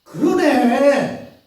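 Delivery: high and loud, drawn out at the end; bystander recognizing him. Acted. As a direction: point out his accent in triumph. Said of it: "마지막 또 사람들이 오더니".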